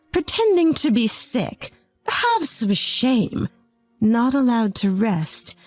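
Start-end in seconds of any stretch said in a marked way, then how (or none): 0.1-3.5 higher, slow sneer; sneering mother-in-law. A narrator's reading. Mother-in-law scorning her feverish daughter-in-law, high slow sneer. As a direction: drag out the contempt, mocking her.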